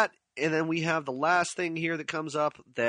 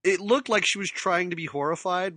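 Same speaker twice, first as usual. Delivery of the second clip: ordinary delivery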